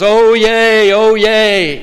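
imitated bellowing proclamation; bellowing town crier. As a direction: bellow it out like a town crier, long held words. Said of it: "he has this bellowing voice".

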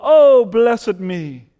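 loud, slow self-satisfied sigh; mock smugness. Mocking a comfortable believer, slow and smug. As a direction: stretch it out smugly.

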